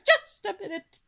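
loud, high interruption; wife cuts in. Acted. Wife stopping her husband's boasting, loud and high. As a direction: cut him off sharply.

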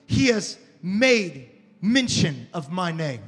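slow, solemn reading with long pauses; slow scripture reading. Shown as a demonstration. Preacher reads Isaiah aloud, slow and solemn. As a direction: linger on every phrase. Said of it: "It says".

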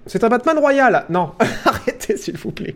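high, laughing protest. Real, not acted. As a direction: laugh while begging them to stop. Chat teasing, he laughs.

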